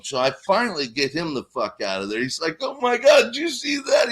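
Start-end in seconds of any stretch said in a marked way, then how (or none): 2.6-4.1 put-on excited drunk. Drunk friend Billy quoted.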